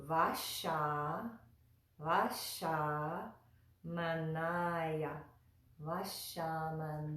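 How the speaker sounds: "Vashamanaya" broken into careful parts with a clear sh; sh-sounding S. Shown as a demonstration. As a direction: sound out "Vashamanaya" in pieces, hushing the sh. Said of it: "S sound. So this is".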